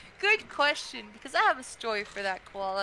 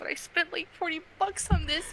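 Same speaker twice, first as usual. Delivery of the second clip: pained protest; 7 semitones higher than usual. Retold or imitated. Retold protest over lost money.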